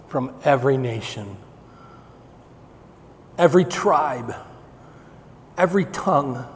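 ordinary delivery

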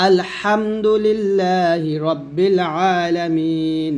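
slow recitation with a pressed throat ha; measured tajweed recitation; Quran recitation. Shown as a demonstration. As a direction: draw out আলহামদুলিল্লাহি slowly. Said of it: "এই হাটা আমরা চাপ দিয়ে নিয়ে আসবো".

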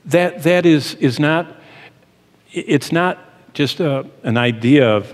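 ordinary delivery

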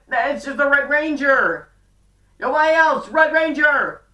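high, loud hyped-up call to the audience; excited announcement. Retold or imitated. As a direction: announce it like breaking big news to fans. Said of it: "so she's like".

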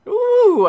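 high, loud whoop; playful high-pitched hoot; goofy ooh. Pretend. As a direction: let out a long high "ooh". Silly sign-off antics.

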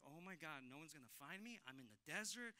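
very soft, as if alone; hushed worried self-talk. Retold or imitated. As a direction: voice the worry under your breath. Acts out worried self-talk in desert.

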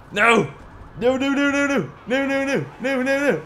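high repeated no's; frantic denial as they close in; panicked pleading. Real, not acted. Police catching up again.

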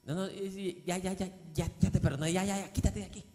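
soft, dismissive brush-off; a hypocrite shooing off the sinner, quietly dismissive; hypocritical dismissal. Pretend. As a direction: wave him away impatiently. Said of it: "va a actuar hipócrita".

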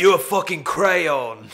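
loud, laughing British jibe; British insult. Acted. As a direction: throw a silly insult in a British accent. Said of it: "An insult that makes no sense".

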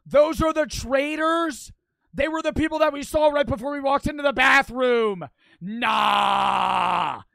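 high, loud, outraged shouting; shouted realisation. Real, not acted. Streamer spotting the traitors on screen, shouted realisation. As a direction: yell it out, stretching the key words.

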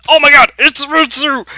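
very high, very loud outcry; alarmed shriek. Real, not acted. Player spotting a dreaded opponent, panicked shout. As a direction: yell it in sudden panic.

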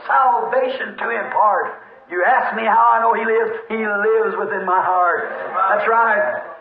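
ordinary delivery

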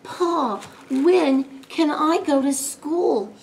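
put-on high, slow, longing child voice; weary kid's drawn-out plea; sighed question. A narrator's reading. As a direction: sigh it out slowly, pleading. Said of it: "he sighed".

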